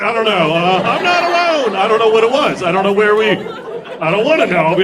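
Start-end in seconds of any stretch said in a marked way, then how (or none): 0.8-1.8 high-pitched mock cry of solidarity; put-on high voice; mock relief. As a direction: pitch it way up, as a clapper finding company. Voicing why the audience claps.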